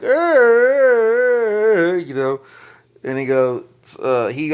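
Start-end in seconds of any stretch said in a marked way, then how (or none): 0.0-1.9 high, loud mock grunt; a short imitated wrestler's grunt; Rock's grunt sound. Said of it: "he does the sound like".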